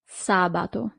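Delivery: Sabato stressed on the first syllable SA, no end accent. Shown as a demonstration. Teacher contrasting Sabato with the days stressed at the end. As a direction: keep Sabato light at the end. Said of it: "No accent here".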